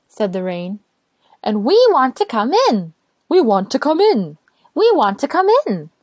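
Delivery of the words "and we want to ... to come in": chanting higher each time; plead, rising with each repeat; two little voices. Acted. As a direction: rain and sun begging together, rising. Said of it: "said their two little voices".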